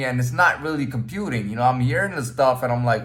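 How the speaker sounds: usual speaking voice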